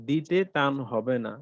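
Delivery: ordinary talk, nothing marked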